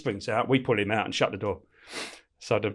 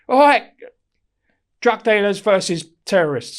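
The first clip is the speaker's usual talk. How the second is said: high, loud announcing of teams; calling out; 9 semitones higher, 7 dB louder than usual. Retold or imitated. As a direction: call it across the yard.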